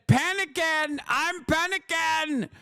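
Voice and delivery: high, slow whiny panic voice; hammed-up panicky president; mock panicking Trump. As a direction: whimper it like a scared coward. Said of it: "He said".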